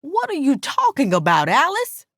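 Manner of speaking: upset, bewildered cry. A narrator's reading. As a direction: plead in confusion, pitch climbing at the end. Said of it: "I cried back to her".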